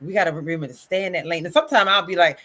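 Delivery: ordinary talk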